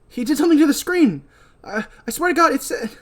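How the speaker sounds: high, loud frightened outburst; panicked shriek. Acted. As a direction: blurt it out in a panic. Julie panics after screen incident.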